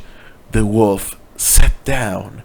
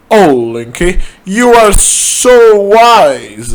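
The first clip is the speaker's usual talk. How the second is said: higher, louder and slow, drawn-out adoration; hammed-up lovestruck gush; swooning admirer; 8 semitones higher, 5 dB louder, slower than usual. A narrator's reading. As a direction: melt over how wise he is. Admirer swoons over him.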